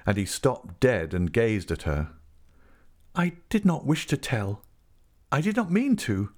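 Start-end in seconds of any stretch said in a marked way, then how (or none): 3.1-6.3 high-pitched, earnest plea; pleading. A narrator's reading. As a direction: plead in a raised woman's voice. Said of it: "she pleaded".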